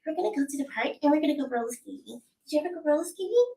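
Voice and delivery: put-on high, fast excited child; little kid voice. Shown as a demonstration. Voice actor's child character excited about roller skating. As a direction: chatter like a thrilled kid.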